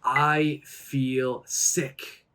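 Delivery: very slow and clear; slow model phrase. Shown as a demonstration. Teacher modelling the phrase slowly for students. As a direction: stretch each word for learners. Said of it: "I could say".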